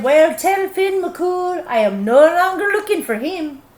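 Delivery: high, loud parting yell; frightened giant's shout. Acted. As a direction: bellow it on the way out the door. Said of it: "he said".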